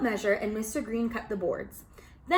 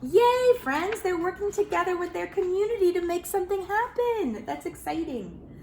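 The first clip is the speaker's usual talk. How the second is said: excited cheer; 9 semitones higher than usual. Acted. Storyteller cheering to young listeners, excitedly.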